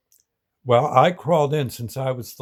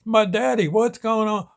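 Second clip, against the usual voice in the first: high, frantic cry of a scared daughter; imitating a daughter panicking over her dad, much higher; retold frantic scream; 10 semitones higher than usual. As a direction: daughter at the crash scene, mock screaming for her daddy. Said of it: "his daughter screaming and yelling".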